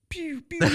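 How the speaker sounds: high-pitched playful firework zaps; imitated rocket sounds. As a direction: mimic bottle rockets shooting off. Imitates bottle rockets firing.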